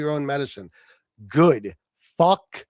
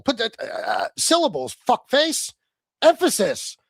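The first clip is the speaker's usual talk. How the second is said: mocking emphasis; 7 semitones higher than usual. Shown as a demonstration. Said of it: "emphasis on the wrong syllable".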